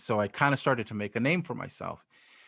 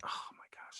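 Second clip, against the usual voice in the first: whispered oh-my-gosh under the breath; hushed, stunned whisper; whispered dismay; 18 dB quieter than usual. Retold or imitated. Dreading having to change his name.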